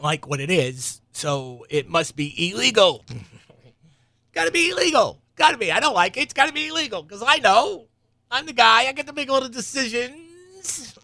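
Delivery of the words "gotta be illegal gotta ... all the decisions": much higher and louder, whiny mock outrage; mocking self-important guy. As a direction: parody a petty know-it-all insisting. Mocking someone who finds things illegal.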